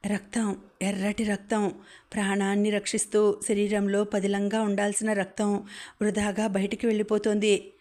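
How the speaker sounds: ordinary delivery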